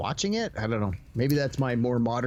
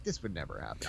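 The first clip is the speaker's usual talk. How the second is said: low muttered aside; 12 semitones lower, 11 dB quieter than usual. Retold or imitated. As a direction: drop it lower and much softer, muttered to yourself. Retelling own disbelief at scene.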